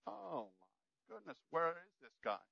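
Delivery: hushed mock exasperation; lost reader. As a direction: fret under your breath, playing someone who's lost their place. Acts out a lost Bible reader.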